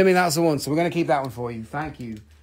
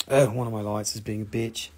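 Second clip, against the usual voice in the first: normal talking voice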